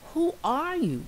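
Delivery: high, eager little mouse cry; cried out. Acted. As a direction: call out high and curious. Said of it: "cried Little Mouse".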